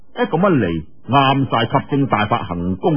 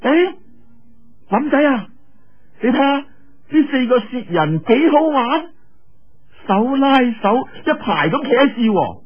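put-on high bright-pitched girlish voice, teasing; playful young girl calling out; 10 semitones higher than usual. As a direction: call to him like an excited young girl. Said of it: "岳靈珊就忽然間叫喎". (岳靈珊高聲嬌俏咁叫林仔)

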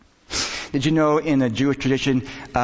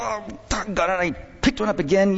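higher, mock self-scolding; chastising yourself; 7 semitones higher than usual. Preacher acts out someone who picked up a novel, self-chastising. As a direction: kick yourself lightly for slipping up. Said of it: "you chastise yourself".

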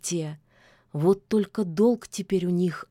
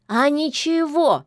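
snorting retort; 8 semitones higher, 5 dB louder than usual. A narrator's reading. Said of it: "фыркнул Баюн".

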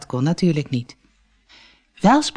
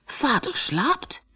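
small, high, slow wondering question; a little boy can't believe his eyes; astonished; 9 semitones higher, 7 dB quieter, much slower than usual. A narrator's reading. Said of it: "Michiel keek heel verbaasd". (verbaasd, hoog en zacht gevraagd)

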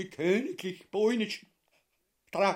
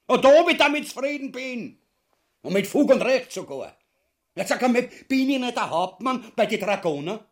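high, loud blustering boast; indignant shouting; 7 semitones higher, 6 dB louder than usual. Acted. Ubu roaring his titles at Mother Ubu, high and loud. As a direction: puff yourself up and roar at her.

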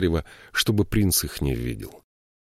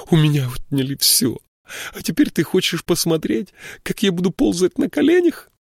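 much higher, loud, near screaming; hysterical shouting; 12 semitones higher, 4 dB louder than usual. A narrator's reading. Varsheva screaming her shame at the prince. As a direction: rage at him, voice cracking high. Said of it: "закричала она".

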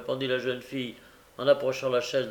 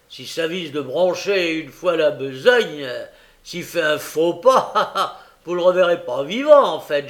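loud, hard threat with a laugh; menacing threat; 5 semitones higher, 10 dB louder than usual. A narrator's reading. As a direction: lean in and menace. Brigand threatens with a crowbar.

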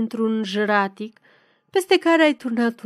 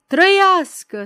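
loud, high rallying shout; howled cheer; 8 semitones higher, 7 dB louder, slower than usual. A narrator's reading. Peasants demonstrating in the square, howled cheer. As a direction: yell it out like a demonstrator. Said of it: "să manifeste urlând".

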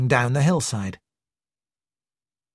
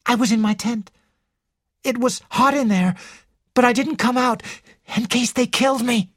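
high, urgent, frightened account; fearful; 10 semitones higher than usual. A narrator's reading. Ginevra explains hiding in her tent, fearfully. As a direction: tell it anxiously, pitched high. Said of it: "She shivered with fear".

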